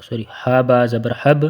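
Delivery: ordinary delivery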